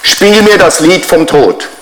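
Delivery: mock loud call to a voice assistant, pitched high; playfully announced, high-pitched command. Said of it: "sage ganz laut".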